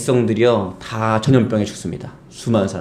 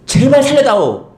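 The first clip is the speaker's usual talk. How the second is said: high, louder desperate plea; begging plea; 11 semitones higher, 4 dB louder than usual. Retold or imitated. Preacher voicing David pleading to spare Absalom. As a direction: cry out to spare him.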